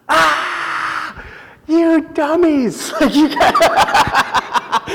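high, giggly laugh through the words; imitating the youth pastor laughing; laughing surfer pastor. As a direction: crack up at them while talking.

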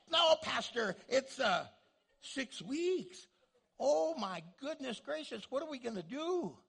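high, hammed-up fretting; mock freak-out. Pastor imitating his congregation over Christmas deadlines, mock freak-out. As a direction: wail it like a comically panicked churchgoer. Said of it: "freaked out a bunch of you".

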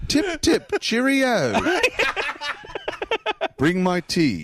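a silly British toff ordering tea; mock British accent. Host teasing the co-host's bad impression, mock British accent. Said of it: "That's perfect, Joel".

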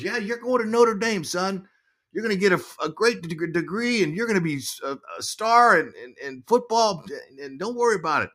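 high-pitched imitation of mom; insistent mom. Mom steering son to Notre Dame, high insistent voice. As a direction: imitate a mother laying down the plan. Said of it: "She goes".

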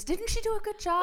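very high, gushing instructor; gushing praise. Retold or imitated. Instructor praising her to the class, high and gushing.

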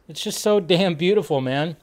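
usual speaking voice

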